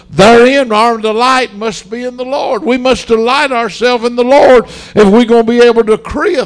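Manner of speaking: fervent preacher's call; preaching at full force. Real, not acted. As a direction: press the point home to the church. Preaching climax.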